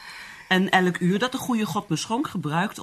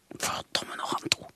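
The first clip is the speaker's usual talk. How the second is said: much softer, voiceless curse; whispered curse; 10 dB quieter than usual. Acted. Anna cursing to herself, whispered. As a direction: swear it under your breath.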